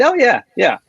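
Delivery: mock-eager yes, pitched high; retold eager parents. Parents finally saying yes to the toy, mock-eager.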